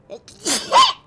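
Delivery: sharp sudden coughs; harsh coughing; coughing fit. Real, not acted.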